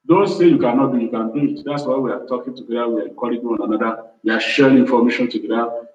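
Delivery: ordinary talk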